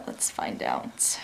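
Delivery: hushed, slow whisper. Real, not acted. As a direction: whisper it to build suspense. Suspense before the taste test.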